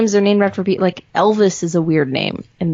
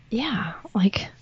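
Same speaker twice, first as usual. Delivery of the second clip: whisper; 9 dB quieter than usual. Real, not acted. Whispered aside in chat.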